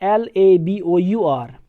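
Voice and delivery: "एल ए बी ओ यू आर" spelled slowly letter by letter, falling on "ए"; British spelling "एल ए बी ओ यू आर" dictated slowly. Shown as a demonstration. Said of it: "जब British में यही word लिखा जाता है".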